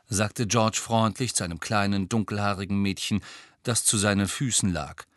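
ordinary delivery, nothing marked

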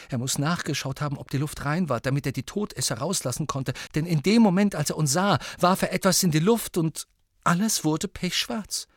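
put-on high-pitched girl's voice; Ginny.

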